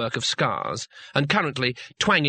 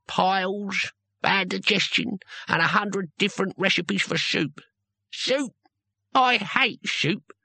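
put-on grumbling old barbarian; 6 semitones higher than usual. Old barbarian complaining to the girl and the wizard, grumbling. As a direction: grumble about age like a toothless old hero.

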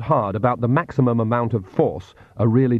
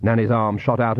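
plain reading voice

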